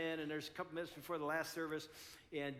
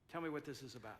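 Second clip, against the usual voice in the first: much softer, lower, tender request; soft gentle plea; 6 semitones lower, 7 dB quieter, faster than usual. Pretend. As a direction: ask gently like a caring spouse.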